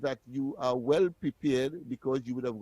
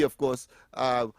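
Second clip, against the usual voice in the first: usual speaking voice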